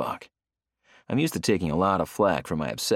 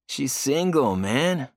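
higher teasing yell; 7 semitones higher, much slower than usual. Acted. Leo teases his friend about the singer, yelling. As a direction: holler it at your buddy. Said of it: "Leo yells".

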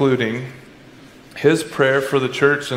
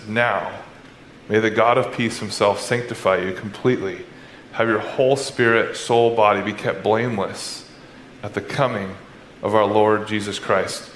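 solemn benediction; 6 semitones lower than usual. Acted. Preacher reading Paul's closing blessing to the church, hushed and reverent. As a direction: lay the words down gently like a prayer. Reads benediction, lower and softer.

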